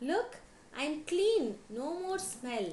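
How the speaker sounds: high, loud delighted elephant; happy shout. A narrator's reading. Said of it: "shouted elephant happily".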